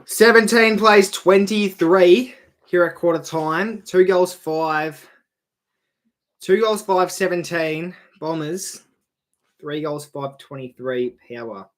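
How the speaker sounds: slow, loud announcer score readout; quarter-time score read. Acted. Streamer announcing the quarter-time score, slow announcer read.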